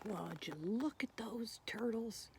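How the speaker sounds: slow hushed whisper of wonder. Acted. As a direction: whisper it so the kids lean in. Reader points out turtles to kids.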